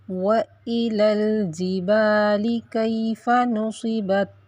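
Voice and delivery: slow melodic tajweed recitation; Quran recitation. Acted. Teacher reciting verse nineteen of Surah Ghashiya. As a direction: recite the verse measured and chanted.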